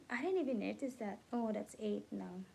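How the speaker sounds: ordinary delivery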